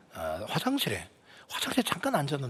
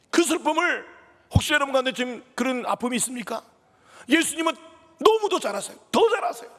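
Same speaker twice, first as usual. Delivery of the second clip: climbing higher and louder to the peak; impassioned preaching; 9 semitones higher, 4 dB louder than usual. Acted. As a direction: cry it out to the congregation. (높아지며 절정으로 치닫는 설교)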